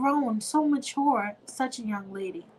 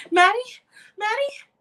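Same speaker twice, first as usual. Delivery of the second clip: high, loud panicked calls for her daughter; frantic calling; 12 semitones higher, 8 dB louder than usual. Acted. Mother searching the house calls her missing daughter, panicked. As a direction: call out in rising panic.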